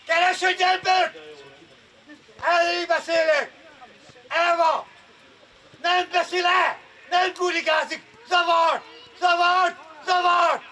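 just holler it across the pitch; shouting. Real, not acted. Coach who cannot see that far, shouting orders. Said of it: "csak ordibálok".

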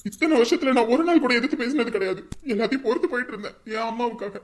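loud furious tirade; angry shouting. Acted. Son disowning his sister, loud angry tirade. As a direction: rage at her and order her out.

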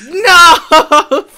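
loud high yell of protest; playful outraged shout. Real, not acted. Rival beaten to a chest, shouted protest. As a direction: cry out as your rival gets the loot. Yelling over a found chest.

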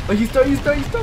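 high, loud alarmed cry; excited shout. Real, not acted. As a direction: shout it out as he spots him. Nemesis appears suddenly.

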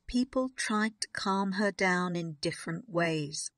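ordinary delivery, nothing marked

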